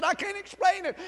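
mildly choked-up retelling, pitched high; retold emotional outburst. Waitress telling her pastor about the prayer, retold emotionally. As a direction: imitate someone getting emotional, voice lifted. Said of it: "She was getting real emotional".

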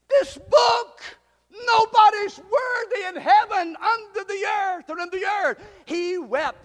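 loud, high, anguished preaching; prédication éplorée. Acted. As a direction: cry out John's heartbreak from the pulpit. Preacher dramatises John's heartbreak.

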